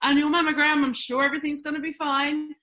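breeze in as if nothing could be wrong; breezy and blasé. Retold or imitated. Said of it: "but very blasé".

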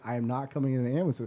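quick, firm reply in own voice; firm retort. Retold or imitated. Kid answering mom about the ambulance, firm refusal. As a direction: answer briskly, close to everyday pitch.

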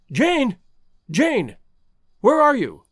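high, urgent call through the house. A narrator's reading. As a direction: call out for someone missing. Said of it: "he cried, and then dashing back into the house".

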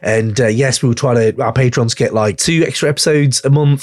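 host signing off, plugging the album and Patreon; podcast outro plug. Acted. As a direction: wrap it up like a host plugging the show.

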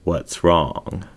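ordinary delivery

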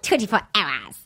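imitating a New Zealand accent. Host repeating the clip line in a Kiwi accent. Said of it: "do you wanna hear it again".

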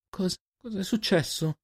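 high, slow, faltering question; shocked stammer. A narrator's reading. Girl finds friend over a body.

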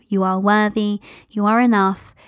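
ordinary delivery

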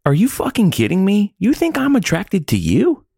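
high, indignant retort; offended outrage. Retold or imitated. Husband mock-offended at his wife's reason. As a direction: throw it back at her, offended. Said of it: "He was, like, so offended".

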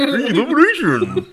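high, drawn-out cartoon announcer yell; cartoon character voice. Shown as a demonstration. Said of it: "you gotta sound like a fucking cartoon character".

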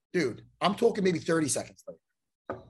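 ordinary talk

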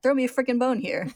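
high, loud exasperated plea; exasperated pleading. Acted. Barry rallies after disappointment, exasperated pleading. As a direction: whine loudly for a little cooperation. Barry begs Al for some reaction.

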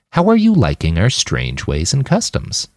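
ordinary delivery

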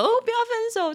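high, hammed-up tearful ex; mock whiny plea. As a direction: whine like a clinging ex, mock. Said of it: "就哭哭啼啼的这样子".

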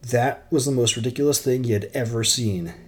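ordinary delivery

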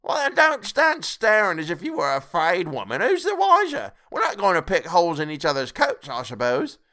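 high, loud challenge; defiant charwoman. A narrator's reading. Charwoman telling the woman not to stare, scolding. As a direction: scold them in a high character voice.

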